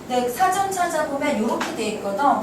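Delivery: ordinary talk, nothing marked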